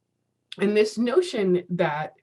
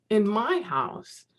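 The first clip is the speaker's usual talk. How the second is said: ordinary delivery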